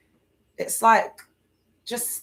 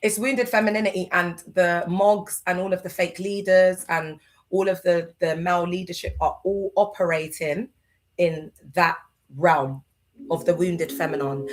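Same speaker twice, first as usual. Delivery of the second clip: normal talking voice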